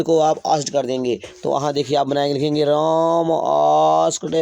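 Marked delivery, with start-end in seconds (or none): 2.6-4.3 slow dictation. Shown as a demonstration. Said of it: "dictating slowly".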